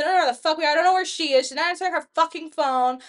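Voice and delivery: loud panicked cursing; frantic yelling. Retold or imitated. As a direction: yell it in drunk panic. Retold panicked drunk rant.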